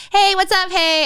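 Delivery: high, chirpy acted hello; casual greeting. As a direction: toss out a high-pitched greeting. Acting her cool greeting to them.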